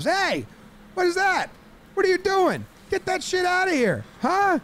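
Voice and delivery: acted-out angry challenge; indignant shouting. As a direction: bark it out, high-pitched and indignant. Voicing players angrily objecting.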